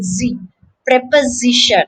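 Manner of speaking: "Z" as zi, then prep-uh-ZI-shun; third-syllable stress. Shown as a demonstration. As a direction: isolate ZI, then stress it in "preposition". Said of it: "the stress is on the third syllable".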